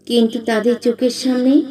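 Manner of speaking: ordinary delivery, nothing marked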